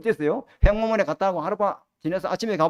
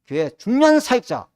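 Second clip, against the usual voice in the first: emphatic preaching; 3 semitones higher, 8 dB louder than usual. Real, not acted. Preacher building to a loud warning.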